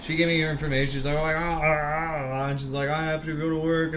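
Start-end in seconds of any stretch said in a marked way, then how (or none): 1.2-2.5 drawn-out tearful wail, imitated; mock crying. Driver in tears giving her information, mock wail. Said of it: "she was in tears". 2.9-3.9 imitated weepy wail, pitched up; mock tearful whine. Driver in tears after the crash, mock weepy whine. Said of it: "she was in tears".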